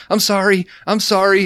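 mock-squeaky apology from the startled partner; apologetic pleading. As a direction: plead sorry in a high little voice. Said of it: "Sam's like".

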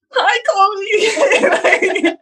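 very high, laughing "Hi, Chloe"; mock awkward squeak. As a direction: squeak it out like a nervous teen. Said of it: "Like this".

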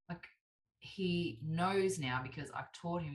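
ordinary talk, nothing marked

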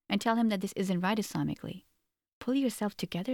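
ordinary delivery

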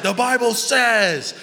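high, slow, booming proclamation; mock a Bible-bashing preacher; pompous drawn-out thundering; Bible-thumper. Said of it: "Bible bashing, thumping".

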